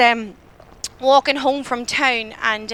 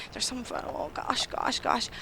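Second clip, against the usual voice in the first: hushed, slow panicked whisper; a scared whisper under the breath; frightened whisper; 11 dB quieter, slower than usual. Retold or imitated. Panicked thought while being followed.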